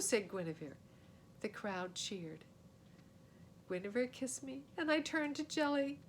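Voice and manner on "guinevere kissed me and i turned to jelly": usual speaking voice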